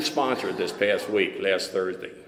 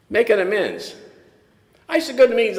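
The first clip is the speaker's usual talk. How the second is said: ordinary talk, nothing marked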